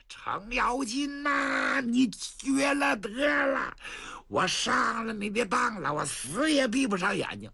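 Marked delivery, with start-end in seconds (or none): 0.1-6.0 slow, high crying shout of despair; wailing lament. Acted. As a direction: howl it out like a betrayed man. Tricked prince wails curses. (哭喊着拉长声)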